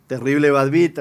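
ordinary delivery

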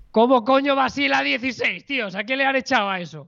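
high, stunned outburst; can't believe the numbers; shocked disbelief. Real, not acted. Shocked at benchmark chart.

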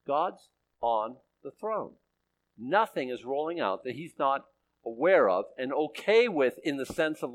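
ordinary talk

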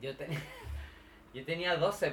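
normal talking voice

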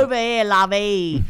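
hammed-up Cockney shout; Cockney accent. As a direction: call across the pub in broad Cockney. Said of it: "He's got that Cockney accent".

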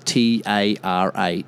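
usual speaking voice

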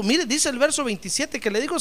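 ordinary delivery

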